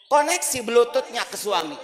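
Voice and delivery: usual speaking voice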